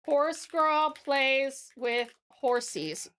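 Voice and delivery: very slow, word by word; dictating a title. Real, not acted. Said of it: "I'm gonna say".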